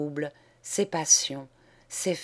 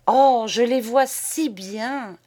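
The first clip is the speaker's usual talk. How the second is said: slow, raised, loud admiring sigh; 6 semitones higher, 7 dB louder, slower than usual. Acted. A hostess admiring the tale, slow and gushing. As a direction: gush over it slowly. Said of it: "admira madame Tiercelet".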